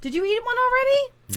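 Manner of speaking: high-pitched mom checking up; scolding mom. Real, not acted. Cohost checks on an early snacker, sounding like a mom. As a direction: question it like a high-voiced mom. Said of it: "why do you sound like mo-, a mom".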